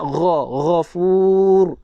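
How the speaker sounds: "ghafur" with gh from the upper throat, long final vowel; correct ghain. Shown as a demonstration. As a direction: slowly model "ghafur", holding the u. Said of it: "tepatkan lagi makhraj dia".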